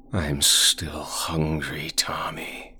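slow, hungry monster whisper; dark whisper behind you. Acted. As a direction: breathe it out like a starving shadow. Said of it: "the shadows whispered".

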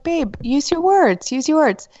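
lightly acted patient-parent voice; public parenting voice. Mom coaxing her child for show in public, mock-patient parenting voice.

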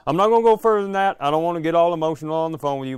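hillbilly on the phone, voice climbing and speeding up; unraveling hillbilly. Retold or imitated. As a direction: rush it out like a hillbilly losing his grip. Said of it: "an unraveling, like, hillbilly".

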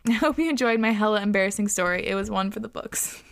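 ordinary delivery

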